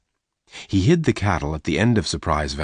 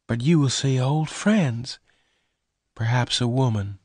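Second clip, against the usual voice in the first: sadly; 6 semitones higher than usual. Acted. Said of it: "Bess said sadly".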